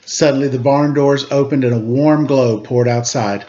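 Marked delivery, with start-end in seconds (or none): none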